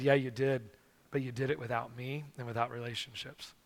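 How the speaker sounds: low, hushed but firm rebuke; soft, gentle, stern. Retold or imitated. God answering the weeping professor, soft and gentle but stern. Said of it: "in such a soft and gentle but, but s-, stern voice".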